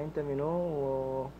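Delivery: ordinary delivery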